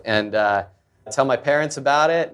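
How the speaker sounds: ordinary talk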